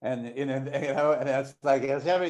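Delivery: ordinary talk, nothing marked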